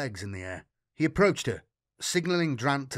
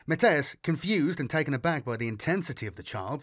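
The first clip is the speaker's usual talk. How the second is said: plain reading voice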